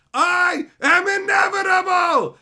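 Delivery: high, loud, slow, booming declaration; hammed-up supervillain bellow; villainous proclamation. Player gloating over his unstoppable exploit, booming. Gamer boasting unstoppable power.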